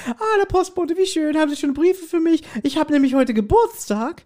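woman's voice. Retold or imitated. Retelling a sketch, mimics the delighted woman. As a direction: play the cheerful lady at the door. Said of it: "da steht da die Frau".

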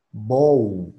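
ordinary talk, nothing marked

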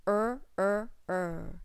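er sound repeated, fading; isolated er sound. Shown as a demonstration. Phonics teacher isolates the er sound. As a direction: draw out the er.

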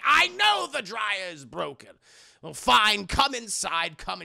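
loud, annoyed grumbling yell; exasperated shout. Retold or imitated. Henry yelling, fed up, letting her inside. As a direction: bark it out in irritation. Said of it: "He's like".

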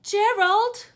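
high, loud call across to a friend. Acted. Piggy calling loudly for Gerald. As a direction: shout the name up high.

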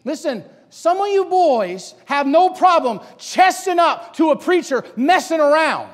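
high, loud sermon delivery; full-force preaching. Real, not acted. Preacher challenging congregation about Samuel's submission, full-force preaching. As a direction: drive each question at the congregation.

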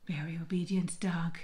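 dry whispered joke; whispered aside. Real, not acted. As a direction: whisper it wryly. Dry aside about dog.

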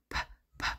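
"P, p" as voiceless puffs; letter P sound. Shown as a demonstration. Teacher sounding out the start of penguin, whispered "p" pops. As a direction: pop "P, p" from the lips without a vowel. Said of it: "The P says p".

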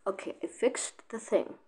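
whispered aside to himself; hushed, breathy report that it's fixed. Real, not acted. Gamer whispering to himself while fixing the thing.

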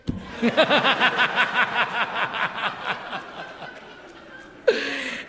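a long, loud belly laugh; hearty laugh. Real, not acted. Audience and speaker laugh.

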